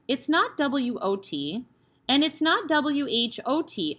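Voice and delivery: slow, careful spelling; very slowly. Acted. Eric hesitantly spells for the headmistress, very slowly. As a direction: spell it out letter by letter. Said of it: "he said very slowly".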